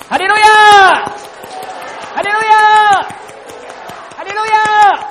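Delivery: loud, high call to the congregation; shouted hallelujah. Real, not acted. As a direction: rouse the crowd to shout back. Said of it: "大声一点啊". (牧师大声带领会众喊哈利路亚)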